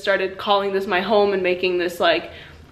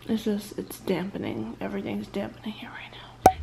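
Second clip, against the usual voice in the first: whispering; 8 dB quieter than usual. Real, not acted. Late night, quiet vlog.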